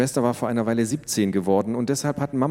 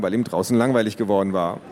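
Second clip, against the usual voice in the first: plain reading voice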